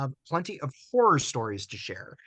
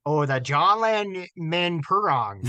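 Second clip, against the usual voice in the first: Minnesotan accent; 4 dB louder than usual. Shown as a demonstration. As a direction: read the name like a Minnesotan. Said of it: "do that one with a Minnesota accent".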